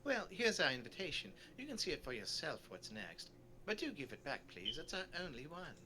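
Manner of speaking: chatty, lilting storybook tourist; polite small talk with swooping pitch; genteel tourist character. Acted. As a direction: play a pleasantly sociable sightseer. Voices the sociable tourist.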